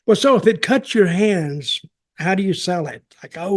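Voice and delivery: usual speaking voice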